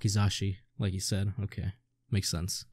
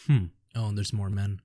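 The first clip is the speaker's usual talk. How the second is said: ordinary talk, nothing marked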